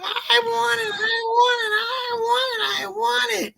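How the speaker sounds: very high, wailing I want it; mock crying tantrum. Fan mocked begging David Yost, high fake-crying tantrum. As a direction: sob it like a toddler's tantrum. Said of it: "So Brent goes".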